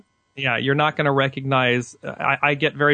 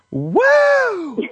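slow build to a high woo; excited whoop; 4 dB louder than usual. Pretend. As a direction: savour it, then whoop. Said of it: "the statement that you could say was".

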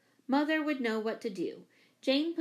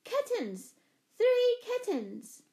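very high, drawn-out joyful call; high child's yell of glee; excited yelling; 13 semitones higher, 4 dB louder, much slower than usual. A narrator's reading. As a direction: sing out the news, stretching it long. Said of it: "still yelling".

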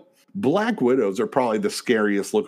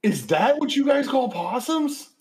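high, slow disbelieving question; can't believe what she's seeing; incredulous; slower than usual. Real, not acted. Shocked at the photo.

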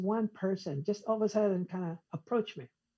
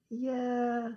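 drawn-out, higher reluctant yeah; holding back a "but"; 3 semitones higher, 5 dB quieter than usual. Retold or imitated. Man told to stop saying but, imitated reluctant yeah. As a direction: imitate someone stopping short of an objection. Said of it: "almost said, "But".

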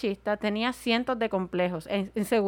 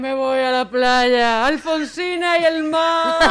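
mock a melancholy poet walking to the sea; tragic poet; 2 semitones higher, 6 dB louder, slower than usual. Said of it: "Un poeta maldito ahí".

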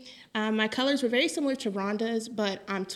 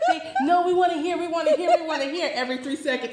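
laughing; 6 semitones higher, 8 dB louder than usual. Real, not acted. Laughing, high and loud.

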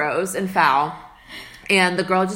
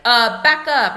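loud snap; 5 semitones higher, 7 dB louder, slower than usual. Retold or imitated. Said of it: "you hear your friend getting, like, yelled at".

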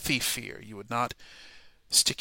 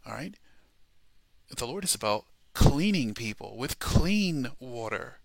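ordinary delivery

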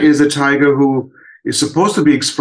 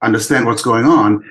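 normal talking voice

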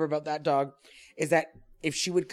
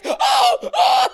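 very high, loud startled cries; imitated yelp of pain; 10 dB louder than usual. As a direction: yelp like a foot just got bitten. Sister yelping at the bite.

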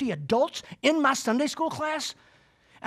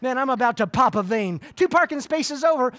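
ordinary talk

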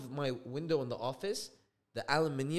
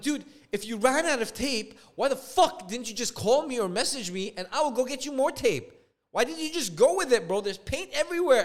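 loud, raised-pitch retold scolding; angry confrontation; 8 semitones higher, 6 dB louder than usual. Homeowner confronting painters who ran out of tape, exasperated. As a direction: demand answers, high and exasperated.

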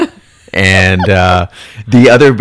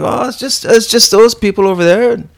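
higher, dismissive newbie excuse; higher mock Nick making excuses; sheepish Nick; 10 semitones higher than usual. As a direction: shrug it off like a clueless kid.

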